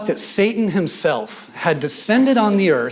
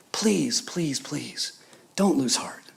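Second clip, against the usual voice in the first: soft, breath-filled pleading; hushed plea; 7 dB quieter, much slower than usual. Acted. Prisoner begging followers not to lose heart, hushed plea. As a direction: plead under your breath, trailing softer.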